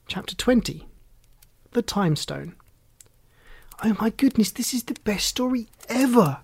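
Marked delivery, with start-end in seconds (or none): 3.8-6.4 put-on high-pitched, bubbling young girl; excited child. A narrator's reading. Rosie praises the story she is hearing, excited child voice. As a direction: gush like a child who can't contain it. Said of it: "who could not contain her excitement".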